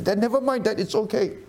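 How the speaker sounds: put-on children reassuring Dad. Children forgiving their apologetic dad, high-pitched imitated reassurance. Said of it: "I say to my children".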